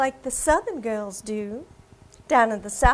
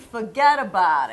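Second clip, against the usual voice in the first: lightly voiced Long Island accent, low; 6 semitones lower than usual. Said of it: "I can talk like I'm from Long Island".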